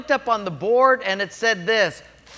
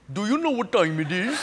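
put-on Indian accent. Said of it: "East Indian accent".

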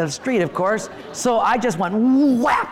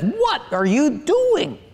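higher, slow, mock-stern wife voice; wife's scolding question; 7 semitones higher, slower than usual. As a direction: imitate the wife asking sharply. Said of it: "she says".

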